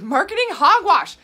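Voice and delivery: marketing hogwash, shouted high and loud; loud scornful outburst. Real, not acted. Vlogger scoffing at shake marketing, loud scornful shout. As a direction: bark it out in loud disgust.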